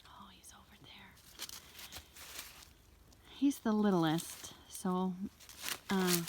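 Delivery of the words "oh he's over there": whispered aside. Real, not acted. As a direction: breathe it out unvoiced. Spotting the goat quietly.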